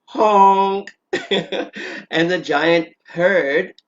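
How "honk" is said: loud honking goose call. Retold or imitated. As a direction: honk like a goose. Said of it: "The goose went".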